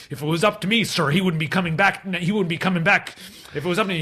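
imitated furious vice principal; red-faced anger. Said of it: "he's just red-faced".

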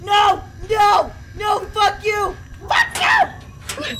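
high shouted curses; panicked yelling. Real, not acted. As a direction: yell as it grabs you. Caught by monster.

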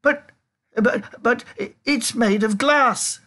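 high, stammering protest; alarmed shout. A narrator's reading. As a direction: splutter in panic. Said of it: "shouted Grandpa Joe".

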